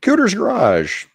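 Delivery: calling out high and drawn out; big hearty hello, stretched; loud greeting. Real, not acted. Greeting a caller by name.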